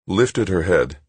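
usual speaking voice

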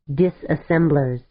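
plain reading voice

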